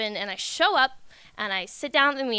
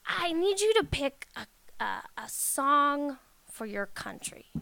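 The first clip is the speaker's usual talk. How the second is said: slow, careful, simplified speech to a foreigner; lightly voiced organizer speaking slowly; slow basic speech; slower than usual. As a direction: spell it out slowly for someone who barely understands. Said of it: "in very basic Spanish".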